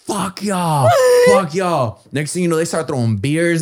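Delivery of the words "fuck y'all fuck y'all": higher taunting shout at the crowd; mock shout. Standing on the car taunting the crowd, shouting. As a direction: yell it from the car roof. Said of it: "I'm going like this".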